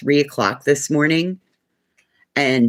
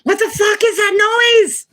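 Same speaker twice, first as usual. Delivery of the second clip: much higher, louder retold wake-up squeal; high, louder groggy alarm; startled self; 6 dB louder than usual. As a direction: woken in alarm at three o'clock. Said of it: "was like".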